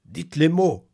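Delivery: high, loud mumbled urging; stammering ape man. Acted. The ape man urges the newcomer, high and loud. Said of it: "bredouilla l'homme singe".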